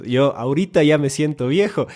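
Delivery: usual speaking voice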